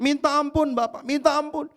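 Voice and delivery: beaten son begging his father. Retold or imitated.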